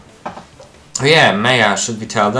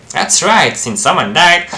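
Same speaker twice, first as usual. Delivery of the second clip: higher, louder acted agitation; agitated outburst; 6 semitones higher, 5 dB louder than usual. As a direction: blurt it out high and forceful. Reacts to locked escape hatch.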